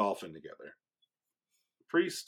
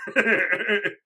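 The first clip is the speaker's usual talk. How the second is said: burst of laughter; 11 semitones higher, 9 dB louder than usual. Real, not acted. Laughs at own story.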